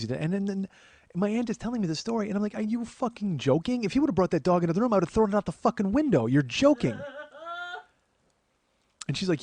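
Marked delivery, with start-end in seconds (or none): 2.5-7.0 high, loud, outraged disbelief; incredulous outburst. Retold or imitated. Telling his aunt he'd throw the dog out, outraged. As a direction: blow up in disbelief at the very idea. Ranting at aunt's story.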